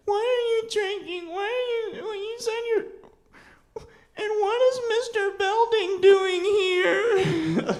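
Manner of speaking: put-on very high, wailing child voice, slowing at the end; crying little boy. Boy confronting his mom in the bar, high tearful wail. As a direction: sob out the question as a scared kid. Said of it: "I was like".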